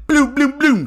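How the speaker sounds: loud, high mock gun blasts; high-pitched "bloom" bursts like gunfire; imitated gunfire. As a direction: fire off booming shots with the mouth. Imitates gunfire through the door.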